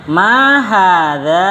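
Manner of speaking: MA drawn out and arched high, HADZA rising at the end; slow, stretched question tune on "Ma hadza"; question intonation. Shown as a demonstration. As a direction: say "Ma hadza" very slowly as a lilting question. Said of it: "cengkoknya juga cengkok pertanyaan".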